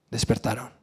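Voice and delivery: hushed "despertaron"; soft reveal. Shown as a demonstration. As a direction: drop to a soft, hushed word. Awakening told softly.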